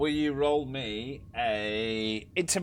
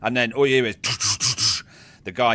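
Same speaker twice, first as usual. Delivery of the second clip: ordinary delivery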